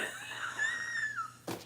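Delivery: long, high wheezing laugh; real helpless laughter. Real, not acted. Host cracking up at store-container trick, laughing helplessly. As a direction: crack up completely.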